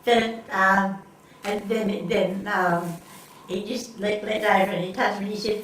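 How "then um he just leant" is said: normal talking voice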